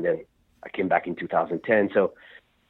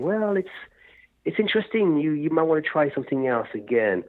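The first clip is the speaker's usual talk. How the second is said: mock diplomatic; 8 semitones higher than usual. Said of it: "instead of being diplomatic and saying".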